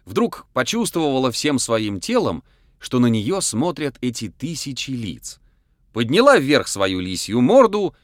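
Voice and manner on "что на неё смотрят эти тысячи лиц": usual speaking voice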